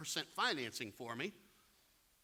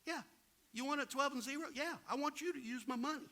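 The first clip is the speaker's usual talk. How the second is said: Carl eager; 9 semitones higher, faster than usual. Retold or imitated. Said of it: "He's like".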